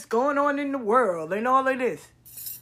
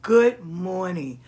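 slow drawn-out greeting; 3 semitones lower, slower than usual. Acted. Host welcomes listeners with a slow, drawn-out good morning.

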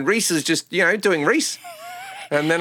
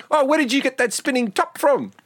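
put-on higher, wonderstruck New Zealand accent; bedazzled New Zealander; 9 semitones higher than usual. New Zealander dazzled by a spinning top, New Zealand accent. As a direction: marvel like a simple Kiwi, voice pitched up. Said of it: "being bedazzled by mundane things".

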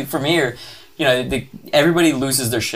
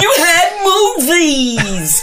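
high, loud, slow sobbing wail, laughing through it; over-the-top crying plea; mock tragic wail; 6 dB louder, much slower than usual. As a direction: wail it like a heartbroken ham. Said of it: "a New York accent".

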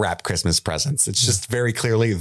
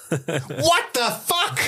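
high, drawn-out disbelief; comically appalled; mock outrage; 12 semitones higher, much slower than usual. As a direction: stretch it out, squeaky and incredulous. Mock outrage at forest punishment.

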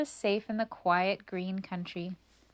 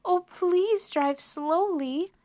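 high, drawn-out plea; anxious pleading mouse; begging; 10 semitones higher, 4 dB louder, slower than usual. A narrator's reading. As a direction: beg the driver to slow down. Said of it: "begged Annie".

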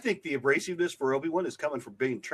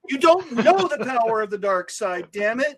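high, loud mock tantrum; hammed-up whiny outburst; whiny Darth Vader; 8 semitones higher, 5 dB louder than usual. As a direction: protest like a petulant kid. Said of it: "ended up with a whinier Darth Vader".

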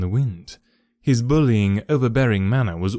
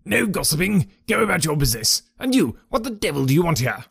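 higher, harsh scolding; bully them off the premises; fierce old trainer; 6 semitones higher than usual. A narrator's reading. Trainer Silas Brown drives off the groom and Holmes, angry and high.

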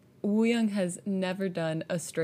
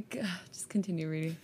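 whispered; 5 dB quieter, faster than usual. Real, not acted. Embarrassed aside.

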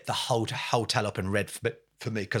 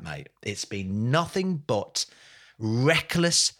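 normal talking voice

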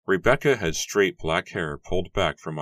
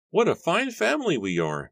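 put-on high-pitched, happy old patriarch; 13 semitones higher, 4 dB louder than usual. A narrator's reading. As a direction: lift the voice in proud delight. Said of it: "Matthew said happily".

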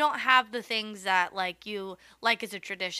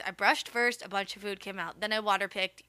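ordinary delivery

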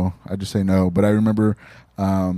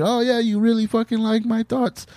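much higher, giddy self-mocking gush; delighted gush; 13 semitones higher than usual. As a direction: squeal it like praise is a drug. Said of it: "I'm like".

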